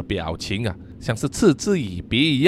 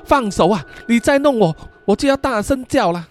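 high, alarmed protest; struggling protest; 7 semitones higher than usual. Acted. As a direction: push him away while warning him. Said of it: "不断的挣扎说". (挣扎着高声抗议)

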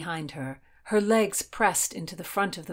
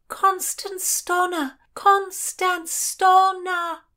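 high, very drawn-out shout, building louder; shouting a name; 13 semitones higher, 6 dB louder, much slower than usual. A narrator's reading. Heroine identifies herself to ladies above, slow high shout. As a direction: shout each syllable so it carries.

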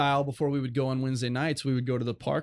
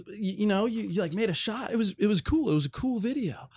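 higher, hammed-up casual mentor praise; imitating mentor; 9 semitones higher than usual. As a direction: mimic him pretending it's no big deal. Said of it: "He goes, he goes".

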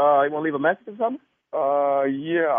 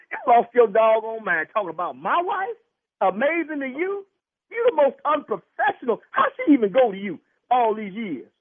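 heated, raised-pitch disbelief; real outrage; 6 semitones higher, 3 dB louder than usual. Real, not acted. Husband attacking the doctor's professionalism, outraged. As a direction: lay into him indignantly.

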